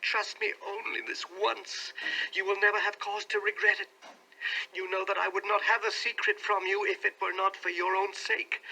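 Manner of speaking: earnest wife in despair; despairing earnestness. A narrator's reading. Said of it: "such earnestness, such despair in her manner".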